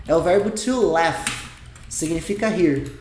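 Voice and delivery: ordinary talk, nothing marked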